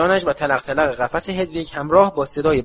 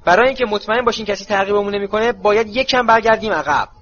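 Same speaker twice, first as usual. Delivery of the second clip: Moody shouting; 7 semitones higher than usual. A narrator's reading. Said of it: "مودی فریاد کشید".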